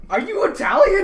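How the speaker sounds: asked through real laughter; laughing. Real, not acted. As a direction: laugh while asking the question. Table cracking up at joke.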